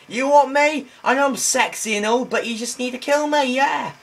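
high, loud, hammed-up bragging; over-the-top high-pitched provocation; mock vain taunt. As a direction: taunt them in a squealing high voice. Taunts enemies in mock vanity.

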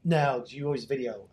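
usual speaking voice

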